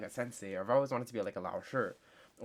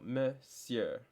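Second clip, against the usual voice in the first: "monsieur" broken up very slowly, syllable by syllable; slow spelled-out demo; much slower than usual. Shown as a demonstration. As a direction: teach "monsieur" slowly, piece by piece. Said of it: "S I, so sieur".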